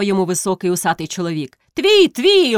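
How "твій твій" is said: high, loud teasing shout; shouted chorus. A narrator's reading. A few villagers shout to Karpo, teasing. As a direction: call out in cheerful agreement. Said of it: "гукнуло ще два-три голоси".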